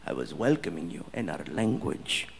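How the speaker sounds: low, measured, dignified explanation; calm prince. Retold or imitated. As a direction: explain patiently as a composed royal. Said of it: "And he's like".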